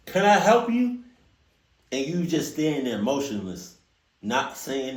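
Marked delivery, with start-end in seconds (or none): 0.1-1.0 high, loud "Can I help you?"; confrontational. Retold or imitated. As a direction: challenge a stranger on your property. Acting out confronting intruder.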